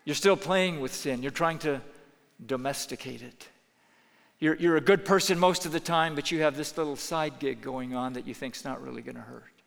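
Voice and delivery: ordinary delivery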